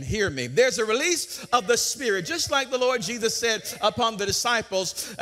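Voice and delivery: usual speaking voice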